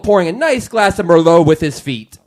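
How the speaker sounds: booming step-right-up showman; circus ringmaster. Acted. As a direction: sell the show like a carnival barker. Continues circus announcement.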